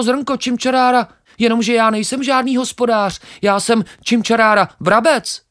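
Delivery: very high, loud, quick chirpy sparrow voice; cheeky sparrow. Acted. Sparrow laughs off the grain, very high and cocky.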